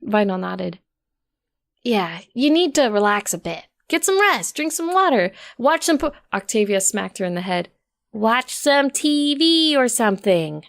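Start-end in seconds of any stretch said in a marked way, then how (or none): 1.8-6.1 higher, breezy advice; Vinyl, casual. A narrator's reading. Vinyl telling Rose to relax, breezy and higher. 8.1-10.6 slow, pointed correction; smooth over the slip deliberately; Octavia, correcting. A narrator's reading. Octavia covering for Vinyl, slow and pointed.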